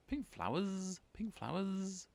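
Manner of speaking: high, loud buzzy bee voice; buzzing bee. Acted. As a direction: buzz it out like a busy bee. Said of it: "buzzed a big stripy bee".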